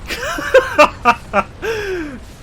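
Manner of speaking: high, loud burst of laughter; real laughing fit. Real, not acted. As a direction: crack up helplessly at your own story. Cracks up at his own story.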